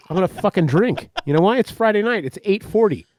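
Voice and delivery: ordinary talk, nothing marked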